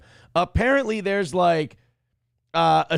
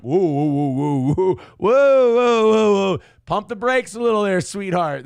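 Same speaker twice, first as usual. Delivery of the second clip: mock brake-pumping; 2 semitones higher, 5 dB louder than usual. Vlogger mock-scolding the passenger, loud teasing whoas. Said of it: "I'm like".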